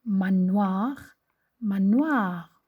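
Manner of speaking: "Manoir" drawn out on its ending, rising then falling; teacher sounding out "manoir" for the rhyme; stressed rhyme sound. Shown as a demonstration. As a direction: stretch the "noir" in "manoir" loudly. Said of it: "on entend le même son".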